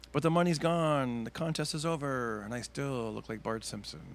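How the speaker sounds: slowed, drooping delivery; deflated punchline. Retold or imitated. Teen comic delivers sad punchline to crowd, slowed down. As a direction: let the punchline sink slowly. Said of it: "And then I'd say".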